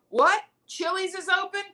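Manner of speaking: high-pitched amazed delight; mock excitement. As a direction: act thrilled at unbelievable news. Acting excitement during lockdown.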